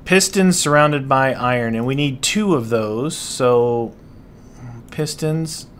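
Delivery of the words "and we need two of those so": ordinary talk, nothing marked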